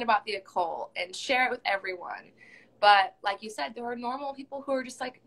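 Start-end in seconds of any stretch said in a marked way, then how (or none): none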